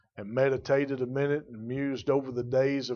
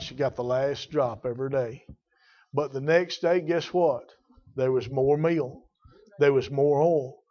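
ordinary talk, nothing marked

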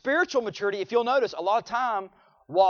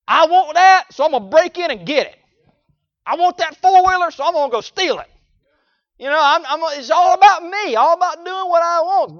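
selfish demand; 9 semitones higher, 11 dB louder than usual. Retold or imitated. Voicing selfish young offender.

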